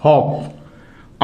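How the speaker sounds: हॉप said low, the second हॉप stretched very slow; drawn-out model word. Shown as a demonstration. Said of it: "अभी पूरा छोटा ओ का sound है". (हॉप को बहुत धीरे खींचकर बोलें)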